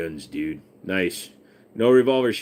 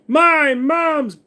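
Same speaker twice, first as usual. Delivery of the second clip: squeaky high voice; 8 dB louder than usual. Pretend. High held put-on voice.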